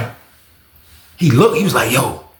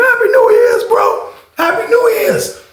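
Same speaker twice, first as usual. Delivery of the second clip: screamed greeting. Retold or imitated. Inmates screaming Happy New Year's to each other, very high. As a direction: scream it down the tier.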